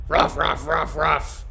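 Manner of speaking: short repeated mock barks; imitated dog bark. Streamer claiming to be biggest dog, playful barking. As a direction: woof like a dog at talking volume.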